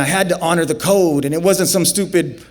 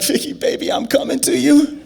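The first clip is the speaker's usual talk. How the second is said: breathless; 10 semitones higher than usual. Retold or imitated. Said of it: "I can barely breathe".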